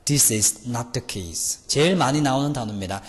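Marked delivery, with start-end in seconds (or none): 0.0-1.6 very slow, spelled-out English; teacher dictating the sentence very slowly; slow dictation. Shown as a demonstration. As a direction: read each word slowly for the class. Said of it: "쓰라".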